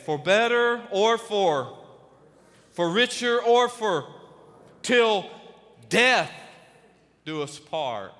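vows recited slowly and loud; hammed-up solemn wedding vows. Shown as a demonstration. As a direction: lean into each word of the vow.

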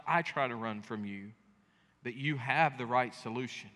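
soft, hushed prayer to God; pastoral prayer. Acted. Pastor leading the church in soft prayer. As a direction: pray quietly for the congregation.

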